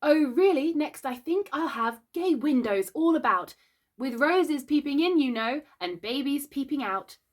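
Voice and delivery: a touch of greedy delight; greedily. Acted. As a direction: reach for it greedily. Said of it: "she answered greedily".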